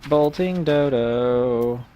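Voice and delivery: very slow, stretched-out reading; dragged-out mock-sleepy drawl. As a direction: drag the words out lazily.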